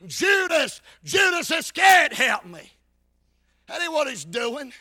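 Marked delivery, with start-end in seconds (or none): 0.1-2.7 higher, louder mock plea; preacher imitating a roadside call; hollering out. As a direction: holler a name for help. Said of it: "hollering out".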